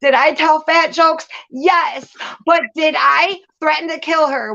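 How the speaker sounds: normal talking voice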